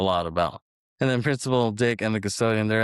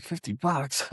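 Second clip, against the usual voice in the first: higher-pitched disbelieving question; mock incredulous echo; 5 semitones higher, 3 dB quieter, slower than usual. Principal hearing the custodian's price, mock incredulous. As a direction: balk at the price, pitch raised.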